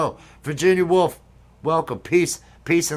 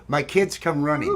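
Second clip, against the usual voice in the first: ordinary talk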